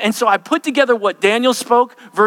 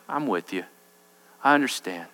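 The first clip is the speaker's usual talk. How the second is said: much softer, lower comfort; soft reassurance; 10 semitones lower, 7 dB quieter than usual. Acted. A friend comforts someone baring their soul, softly. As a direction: soothe them gently.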